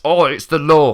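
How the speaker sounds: loud pompous officer voice; mock official bluster. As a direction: bark it like a self-important official. Licence officer throwing big words.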